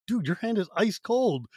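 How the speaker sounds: high, slowed-down amazement; astonished. Retold or imitated. Retelling shock at a friend's cold handshake, astonished. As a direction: stretch it out in disbelief.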